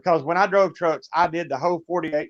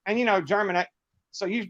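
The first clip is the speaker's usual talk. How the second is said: ordinary talk, nothing marked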